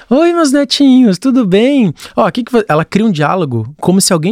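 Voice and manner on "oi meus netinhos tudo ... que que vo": high-pitched, drawn-out sweet hello to an audience; imitated grandma greeting. Grandson mimics grandma talking to her netinhos, high greeting. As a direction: imitate grandma chirping slowly to her followers. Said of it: "Aí ela vai".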